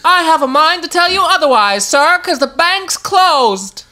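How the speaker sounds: high, hammed-up old-timey indignation. Rebuffing a sir's advances, old-timey voice. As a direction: put an admirer in their place, slowing at the end. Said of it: "In an old-timey voice".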